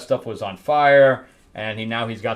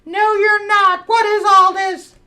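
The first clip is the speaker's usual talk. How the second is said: mock indignant protest; 5 dB louder than usual. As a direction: squawk in hammed-up alarm at bats. Villainess protesting the bat attack.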